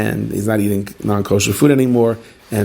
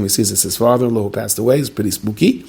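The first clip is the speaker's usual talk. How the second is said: ordinary talk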